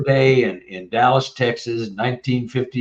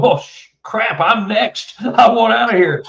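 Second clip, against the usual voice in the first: higher, half-laughing retold alarm; mock panic; 10 semitones higher than usual. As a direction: play the scared kid who wants out. Acted panic of being next.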